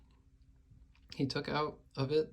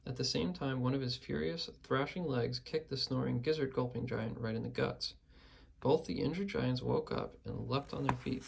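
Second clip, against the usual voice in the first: ordinary delivery, nothing marked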